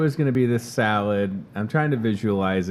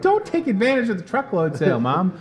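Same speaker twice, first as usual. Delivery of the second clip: higher, teasing plea; mock plea to mom; 8 semitones higher than usual. Son teasing mom about the truckload sale, higher mock plea. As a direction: tease her in a higher voice. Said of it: "I'm like".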